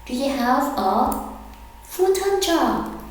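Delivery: slow, broken into two parts, full-time rising high; slow, split model. Shown as a demonstration. Teacher slowly modelling the question for students. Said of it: "Sentence ten".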